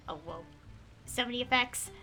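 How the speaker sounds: normal talking voice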